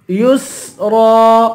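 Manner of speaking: یسرا very slowly with a sustained س; whistled seen. Shown as a demonstration. Teacher showing the letter seen, یسرا with whistling س. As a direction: hiss the س in یسرا, draw out the ending. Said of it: "سین کی سیٹی نکالیں گے".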